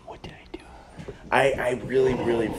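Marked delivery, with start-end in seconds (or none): 0.1-0.6 barely audible, voiceless whisper; whispered question. Real, not acted. Puzzling over what just happened, whispered question. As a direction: whisper it to yourself. Muttering to self over the game.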